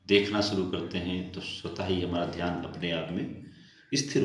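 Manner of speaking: guided meditation. Real, not acted. Leads a seated meditation.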